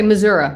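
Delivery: "Missouri" with an "uh" ending; variant pronunciation. Shown as a demonstration. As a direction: say "Missouri" like "Mizzour-uh". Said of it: "Some people say Missouri".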